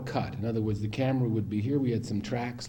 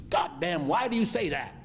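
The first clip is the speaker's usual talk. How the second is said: retold exasperated protest, pitched higher; angry outburst; 6 semitones higher than usual. Actor snapping back at director's Oscar promise, mock angry and high-pitched. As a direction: imitate an actor losing his temper. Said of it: "now he really got angry".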